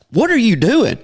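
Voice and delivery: high-pitched baffled question; incredulous, voice jumping up; retold astonishment. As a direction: act out being startled by a friend. Startled at friend popping out.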